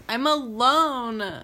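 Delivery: slow, loud wail of "I'm alone"; mock sobbing. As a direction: wail it out slowly like a hammed-up sob. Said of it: "And she's like".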